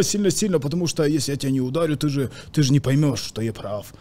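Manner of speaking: ordinary talk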